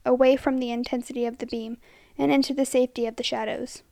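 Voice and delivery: usual speaking voice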